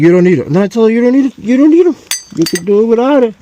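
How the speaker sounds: high, loud insisting. Retold or imitated. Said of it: "I'm like".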